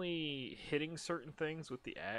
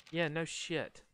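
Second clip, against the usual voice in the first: normal talking voice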